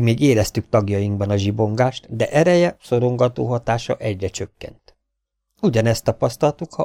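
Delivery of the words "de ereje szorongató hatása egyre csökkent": usual speaking voice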